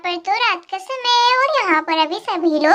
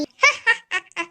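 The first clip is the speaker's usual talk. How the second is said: evil cackle. Acted. Witch's laugh after threat.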